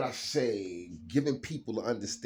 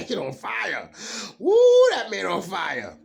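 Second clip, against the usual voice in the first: hyped up; 12 semitones higher, 17 dB louder than usual. Real, not acted. Hyping the fire card.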